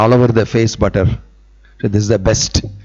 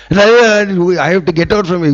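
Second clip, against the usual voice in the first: pleading gopi; 7 semitones higher, faster than usual. Acted.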